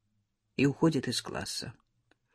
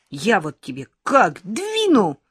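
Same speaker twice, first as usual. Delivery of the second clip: slow, louder mild fury; angry threat; 4 semitones higher, 6 dB louder, slower than usual. A narrator's reading. Lyolka threatening Sashka, mild angry threat. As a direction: threaten him, a touch of rage. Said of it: "разозлилась Лёлька".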